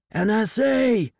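high, drawn-out shouted announcement; loud agent identifying himself; shouting. A narrator's reading. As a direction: call it out across an armed standoff. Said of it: "Durek shouted".

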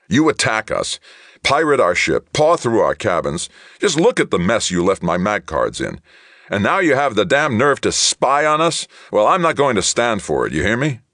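loud, high, outraged protest; indignant shouting. A narrator's reading. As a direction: hammer each complaint with righteous anger. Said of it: "righteous indignation he could into both voice".

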